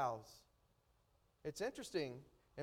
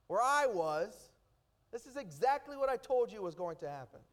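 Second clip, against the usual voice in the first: Jonah complaining; 5 semitones higher, 3 dB louder than usual. Acted. Preacher voices complaining Jonah.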